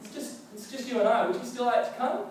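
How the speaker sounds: high, bright, laughing invite; nervous teen ask. Retold or imitated. Shy teen asking Tiff to the movies, high and giggly.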